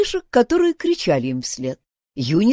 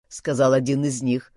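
plain reading voice

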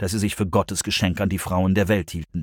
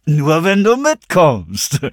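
loud, raised, very drawn out; slow, goading sing-song; shameless taunt; 6 semitones higher, 5 dB louder, much slower than usual. A narrator's reading. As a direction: taunt her while holding on. Grinning man taunts the held girl.

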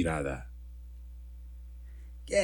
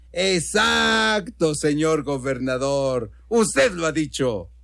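put-on drunken heckler's cry; slower than usual. Drunk in the crowd to the governor, crying out approval. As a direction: call out long agreement to the speaker. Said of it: "volvió a oírse el grito de antes".

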